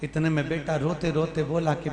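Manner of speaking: ordinary talk, nothing marked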